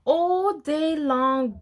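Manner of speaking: plain reading voice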